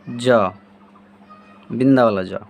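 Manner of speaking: "ज" as a z-like sound, said low; letter sound demo. Shown as a demonstration. Teacher models "ज" with the dot as a z-sound. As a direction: stress the dotted "बिंदा वाला ज" as z. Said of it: "इसका उच्चारण हो जाएगा".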